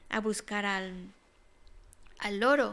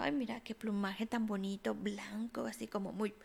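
dreamy whisper; 2 semitones lower, 7 dB quieter than usual. Acted. Swan admires its own plumage.

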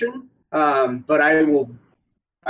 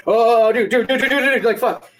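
mock urgent, higher-pitched warning; alarmed onlookers; 5 semitones higher than usual. Everyone reacting as the man comes beelining out, imitated alarm. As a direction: rattle off the repeats as imitated panic.

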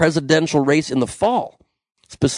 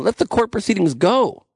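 usual speaking voice

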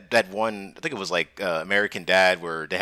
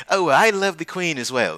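higher-pitched put-on British voice; British accent; 7 semitones higher than usual. Old Gibraltar man switching from Spanish, mock British accent. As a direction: switch abruptly into pompous British. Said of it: "will switch in between".

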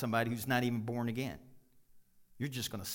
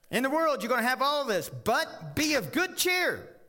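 rousing scripture proclamation; loud preaching; 11 semitones higher, 6 dB louder than usual. Acted. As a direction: proclaim it like good news.

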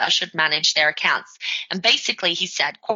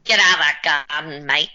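a light attempt at an Australian accent, Steve Irwin style; Aussie accent; 3 semitones lower than usual. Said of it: "in your best Aussie accent".

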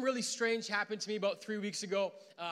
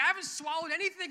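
flustered reply; 9 semitones higher, 6 dB louder, faster than usual. Retold or imitated. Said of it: "I was like".

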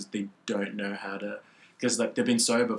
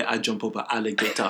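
joking put-on African accent; 3 dB louder than usual. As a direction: boast in a mock African accent. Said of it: "African accent".

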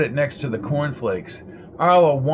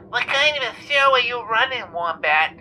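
put-on much higher, griping old lady; irate caller; 10 semitones higher than usual. A narrator's reading. Jenny calling the radio show, irate. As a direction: complain shrilly down the line.

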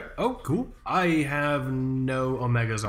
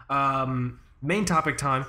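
normal talking voice

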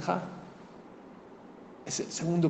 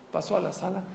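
ordinary delivery

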